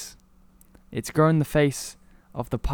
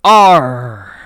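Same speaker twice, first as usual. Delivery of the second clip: a loud pirate "Arr!"; pirate growl; 15 dB louder than usual. Acted. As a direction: bellow it like a pirate off in the distance. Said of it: "all of a sudden we hear".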